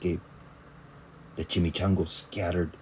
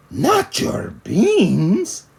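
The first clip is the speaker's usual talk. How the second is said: slow, higher, louder outrage; outraged cry; 10 semitones higher, 6 dB louder, much slower than usual. Acted. Skipito crying out outraged to Poquito. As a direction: stretch it out in shock. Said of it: "cried Skipito, outraged".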